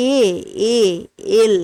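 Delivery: "এল" broken down to its opening "এ" sound; "এ", pause, "এ", pause, then full "এল"; vowel onset shown. Shown as a demonstration. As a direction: isolate the "এ" that starts "এল", with pauses. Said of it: "আমি যখন উচ্চারণ করছি".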